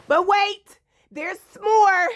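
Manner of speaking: loud, high infomercial tease; teasing announcement. Real, not acted. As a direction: sell it like an ad.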